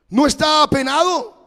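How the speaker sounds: high, loud, urgent voice of a worried church brother; worried brother calling the pastor, high and insistent; anxious caller. Retold or imitated. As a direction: sound like a fretting caller pressing the pastor. Brother presses the pastor on the phone.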